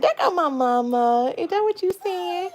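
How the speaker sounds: put-on high playful baby voice; voicing the baby. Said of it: "He be like".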